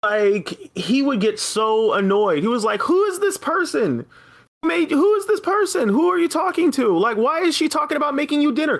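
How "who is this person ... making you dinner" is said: higher, pushy imitation of a jealous boyfriend; mock jealous boyfriend. Retelling how the clingy boyfriend interrogated her, mock jealous. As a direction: mimic him grilling you, lightly hammed.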